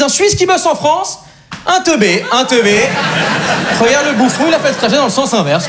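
high-pitched imitation of scoffing onlookers; mocking jeer. French audience judging the Swiss newcomer, mocking jeer.

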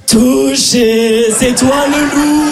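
put-on slow, sing-song child's playground call; hammed-up kid calling out tag. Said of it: "en disant". (gamin qui chante dans la cour, lent et haut)

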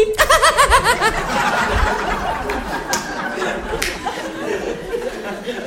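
high breathy mock giggle; girly giggle. Girls giggling together, high mock titter.

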